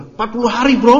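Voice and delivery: high, loud casual bro slang; Dokter Tirta. Retold or imitated. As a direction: toss it off like a chill influencer. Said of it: "Kalau bahasa Dokter Tirta".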